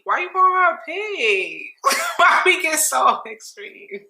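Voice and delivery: high, slow mock outrage. Imitating her own outraged reaction to Kenya's pig insult. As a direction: act scandalised by the pig insult. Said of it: "And I'm like".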